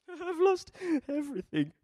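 high-pitched, loud mock wail; mock crying. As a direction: wail it like a hammed-up crybaby. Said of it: "curl up in a ball and cry".

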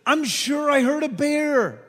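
put-on loud, high, drawn-out kid's alarm; imitated alarmed kid. Middle school kid warning the leader in the woods, imitated alarm. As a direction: mimic a kid announcing danger. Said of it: "one of these kids says".

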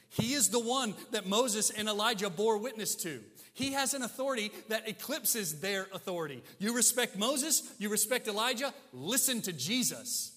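loud, high, insistent sermon; full-force preaching. Real, not acted. Preacher urging listeners to put Jesus above the prophets, forceful. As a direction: drive it home to the congregation.

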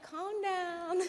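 high and laughing through it; laughing calm down. Retold or imitated. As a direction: tell her to calm down while cracking up. Laughing at her eager friend.

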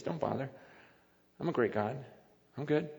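low, soft, unbothered reply; God's quiet dismissal. Acted. God answering someone who doubts him, low and unbothered. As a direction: say it quietly, with calm finality.

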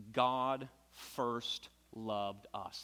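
very slow, spaced scripture line; slow reverent reading. Acted. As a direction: let each word land. Quoting scripture slowly, reverently.

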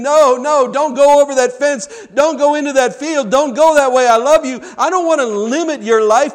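high, loud, anguished pleading; acted crying voice of God, preached loud; weeping plea. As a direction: cry out like a grieving father begging. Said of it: "you can hear God weeping, the cry-, weeping, crying voice of God".

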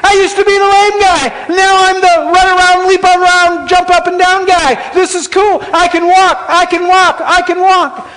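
high, loud ecstatic shout; joyful yelling. Acted. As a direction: yell it out like a man who just got his legs back. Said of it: "He starts yelling".

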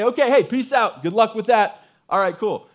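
high, loud, casual send-off; mock breezy sign-off. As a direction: toss off a flippant goodbye. God mockingly voiced as casually leaving.

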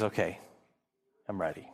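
soft, low, settled release; quiet calm acceptance. Retold or imitated. Preacher voicing Simeon ready to die, quiet and at peace. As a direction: let it go gently, at peace.

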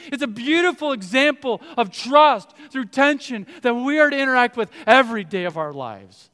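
high, loud, driving sermon voice; rising fervent preacher's call; full-force preaching. Acted. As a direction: preach it to the back row.

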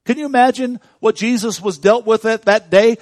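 loud, high preaching swell; fervent pulpit cry; preaching at full force. Acted. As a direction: build the pain to a roar. Preaching about Lazarus' tomb.

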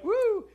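high, loud whoop of joy; a father yelps as he starts to run, giddy high whoop; excited whoop. Retold or imitated. Father hikes his robe and runs, a high loud whoop. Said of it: "hike up his robe like a little girl".

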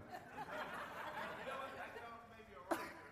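audience bursts into laughter; long laugh from the room; crowd laughter. Real, not acted. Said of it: "Why are you laughing?".

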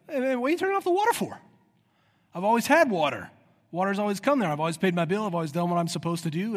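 high, quick protest, settling into insistent complaint; indignant customer. Acted. Preacher playing a resident whose water was cut off, indignant. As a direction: act baffled outrage, pitch jumping up at the start. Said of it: "You're gonna be like".

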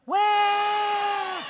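high, loud fake baby wail; mock baby cry. Shown as a demonstration. Leading kids in the crybaby sound, mock wail.

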